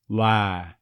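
"Lie" said as "lah"; halved diphthong. Shown as a demonstration. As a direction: flatten "Lie" to "lah", no glide. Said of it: "Dropping the second half of the I diphthong".

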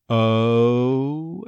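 long "O" gliding from schwa to oo; neutralized "O". Shown as a demonstration. Coach isolates the diphthong, drawn-out "O". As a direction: stretch "O" starting from uh. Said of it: "neutralizing the first half".

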